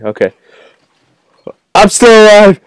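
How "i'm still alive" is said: high, loud survival cry; excited shout. Real, not acted. Gamer surviving glass break, excited shout. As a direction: yell it in high-pitched triumph.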